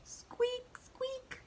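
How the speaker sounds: tiny high-pitched squeaks; mouse squeak. Acted. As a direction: squeak softly like a little mouse. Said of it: "The mouse says".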